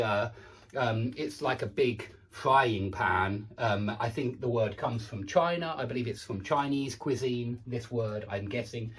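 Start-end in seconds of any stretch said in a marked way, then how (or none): none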